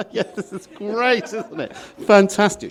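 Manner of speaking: high mock-cheerful sarcasm; sarcastic. Stranded in Barcelona, mock cheerful sarcasm. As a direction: fake delight at a disaster. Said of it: "I'm just sitting there going".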